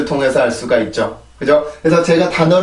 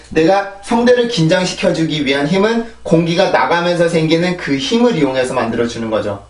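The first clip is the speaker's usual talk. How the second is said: ordinary delivery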